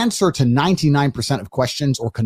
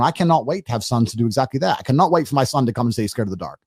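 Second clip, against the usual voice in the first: ordinary talk, nothing marked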